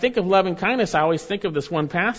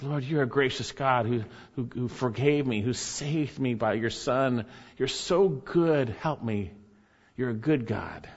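quiet prayer; 5 semitones lower, 6 dB quieter than usual. Shown as a demonstration. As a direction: pray it gently, half to yourself. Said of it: "just to remember for yourself as you pray".

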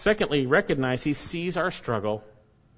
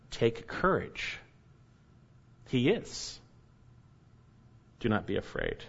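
tender reassurance; 2 semitones lower, 6 dB quieter than usual. Acted. Pastor comforting believers in trials, soft and tender. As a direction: gently lift the frightened listener.

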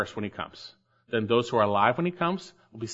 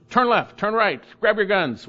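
lightly voiced drill order, a bit louder; barked army command, half-hearted; mild officer's command; 6 semitones higher, 3 dB louder than usual. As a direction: play a military officer giving orders. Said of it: "a military officer to his soldiers".

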